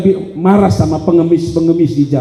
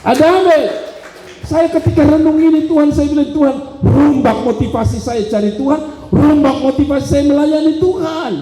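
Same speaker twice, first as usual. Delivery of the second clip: forceful preaching; 9 semitones higher than usual. Acted. Preacher crediting God's mercy and praying for changed motives, high and impassioned. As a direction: stretch the key words in a raised voice.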